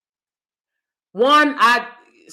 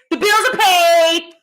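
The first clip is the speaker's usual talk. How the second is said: higher, slow, savoring it; sweet line; 8 semitones higher than usual. Pretend. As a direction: dream of hearing it. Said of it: "The only thing I wanna hear from a man is".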